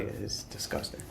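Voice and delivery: breathy whisper of disgust; a hushed, scornful aside about German. Real, not acted. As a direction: whisper it like something tastes bad. Mocking the German language.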